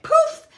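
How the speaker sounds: high, loud "poof"; sudden comic burst of sound; imitated kick noise. Medium acts out a spirit kicking butt, comic high "poof".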